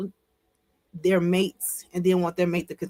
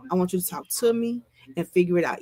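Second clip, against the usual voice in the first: usual speaking voice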